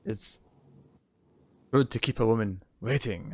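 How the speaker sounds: lightly voiced Van Damme-style Belgian accent; a half-hearted try at a Belgian accent; mock Belgian accent. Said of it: "Belgian accent".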